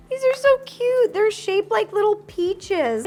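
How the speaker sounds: high, bright delight at something cute; delighted squeal. Real, not acted. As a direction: gush over how cute it is. Delighted by peach gummies.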